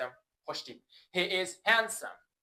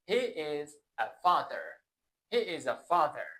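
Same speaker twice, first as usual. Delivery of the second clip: usual speaking voice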